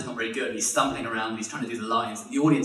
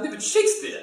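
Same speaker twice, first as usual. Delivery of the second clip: very high-pitched theatrical protest; insistent hammy actor. Retold or imitated. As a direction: insist on your art, voice way up. Actor insists to baffled man.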